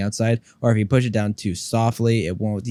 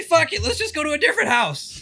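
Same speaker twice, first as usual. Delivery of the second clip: high mock voice. Joking suggestion in high voice.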